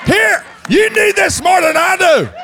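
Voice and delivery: high, loud sarcastic jab with a laugh; laughing high-pitched jab at the official; mock taunt to the ref. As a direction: hand the ref your glasses and sneer. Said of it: "try to hand them to the officials".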